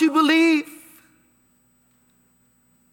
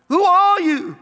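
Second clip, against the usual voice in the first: Moses asking; 6 semitones higher, 4 dB louder than usual. Acted. Moses questioning God, retold.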